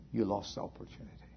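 whispered, fast and soft; mournful hushed verdict; quiet lament. Acted. Same words repeated, soft then loud.

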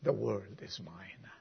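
soft unvoiced whisper; whispered end of the refrain. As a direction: breathe the words as a whisper.

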